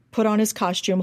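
ordinary talk, nothing marked

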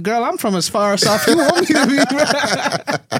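high, slow, playful flirting; mock flirt to the cashier; flirty boast. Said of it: "I was like".

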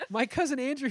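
laughing through the line; giggling while acting it out. Acting Tobey as Spider-Man.

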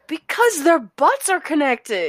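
slow, high, comically uneasy; high-pitched explanation. Real, not acted. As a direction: draw it out slowly in a high voice.

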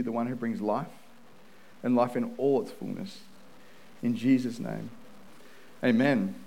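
earnest opening prayer to God, softening to the amen. Acted. Pastor praying before the sermon, earnest and humble. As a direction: pray over the congregation, humbly and steadily.

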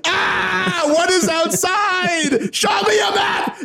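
very high, frantic mock screaming; really loud yelling. Hero questioning the innkeeper, hammed-up frantic screaming. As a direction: shriek each question like a hopelessly clueless hero. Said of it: "wow, that was really loud".

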